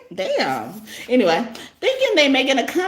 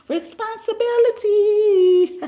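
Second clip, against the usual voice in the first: mocking drawl; 9 semitones higher, slower than usual. Mocking drawn-out word.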